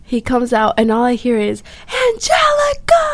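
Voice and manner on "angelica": a high shrieked name, the dad calling from the doorway; imitating a dad yelling her name sharply, pitched way up; retold scream. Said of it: "It was the scream that got to me".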